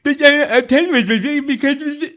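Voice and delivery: high, loud mock sputtering; mock stammering. Host mimics debater floundering for an answer, mock stammering. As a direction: imitate a flustered man fumbling for excuses.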